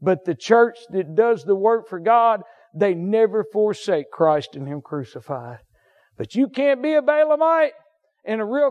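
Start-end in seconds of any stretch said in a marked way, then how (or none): none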